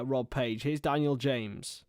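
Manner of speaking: ordinary talk, nothing marked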